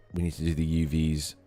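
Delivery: ordinary delivery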